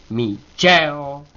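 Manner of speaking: "Mi cheo" with "che" high and loud; strong accent. Shown as a demonstration. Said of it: "acento fuerte".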